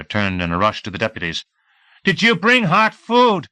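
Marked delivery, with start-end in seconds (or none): 2.0-3.5 much higher, louder, slow desperate ask; insistent plea. A narrator's reading. Hungry deputy turning to the others, more insistent plea. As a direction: beg again more urgently.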